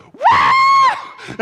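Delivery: very high loud whoop of delight; joyful whoop. Pretend. Child finding dream gift outside, ecstatic whoop. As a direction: scream with pure joy. Kid cheering big present.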